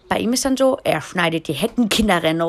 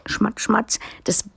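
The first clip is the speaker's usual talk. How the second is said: usual speaking voice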